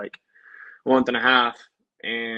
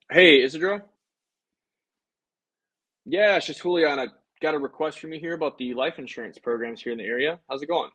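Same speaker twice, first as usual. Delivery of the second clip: higher, bright-toned sales-call voice; friendly cold-call opener on the phone; upbeat phone greeting; 4 semitones higher than usual. Real, not acted. Insurance agent cold-calling a lead, upbeat and higher.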